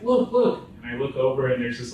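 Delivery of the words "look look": high, loud, urgent prompting; acted alarmed nudge; imitated urgent alert. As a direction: urge a friend to look, pitched way up. Acts Ronnie urging him to look.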